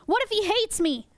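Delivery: high-pitched pretend worry; mock alarm. Presenter frets the sea cucumber hates her, mock alarm. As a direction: play up sudden worry.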